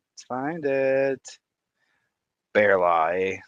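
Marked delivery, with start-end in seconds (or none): 2.5-3.4 low, loud mock Canadian accent; playful Canadian accent. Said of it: "correct accent, too".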